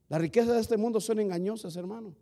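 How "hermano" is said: usual speaking voice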